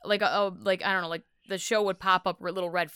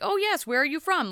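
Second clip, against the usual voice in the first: mock polite; 9 semitones higher than usual. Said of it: "by his response when he was like".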